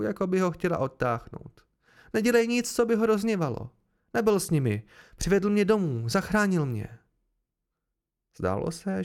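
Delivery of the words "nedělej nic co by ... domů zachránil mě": high, loud, urgent pleading; daughter's raised, insistent voice; blurted plea. A narrator's reading. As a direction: plead with your father in a rush of worry.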